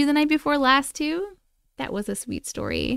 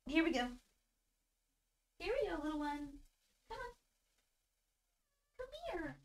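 high, soft cooing to a pet; 4 semitones higher, 13 dB quieter than usual. Real, not acted. Streamer holding her cat up to viewers, soft baby talk. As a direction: coo at the little cat tenderly.

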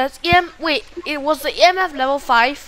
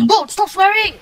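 scolding; 8 semitones higher, 6 dB louder than usual. Real, not acted. Scolding teammate for swearing.

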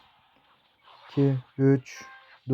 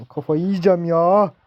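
exasperated outburst; 3 semitones higher, 6 dB louder than usual. Real, not acted. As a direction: let the frustration burst out on the repeat. Frustrated gamer repeats louder.

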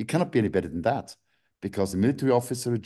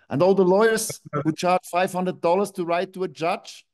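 angry outburst; 6 semitones higher than usual. Real, not acted. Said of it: "I'm angry now".

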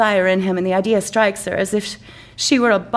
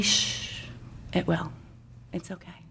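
soft, hushed reassurance; calm her down gently; a soft comforting murmur; soothing hush; 2 semitones lower, 13 dB quieter than usual. Acted.

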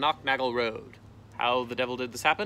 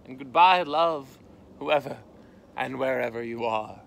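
mock-tragic goodbye; 5 dB louder than usual. A narrator's reading. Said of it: "said Tifky in his mind".

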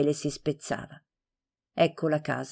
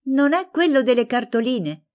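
jumps in much higher and a bit louder; eager blurt; 8 semitones higher, 4 dB louder than usual. A narrator's reading. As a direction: cut in with nosy excitement. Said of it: "saltò su Maria Luisa".

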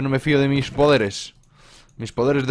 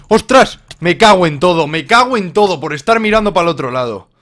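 loud, higher frustrated swearing; angry swearing; 5 semitones higher, 7 dB louder than usual. Real, not acted. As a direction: curse loudly at the loss. Dies in game, swearing.

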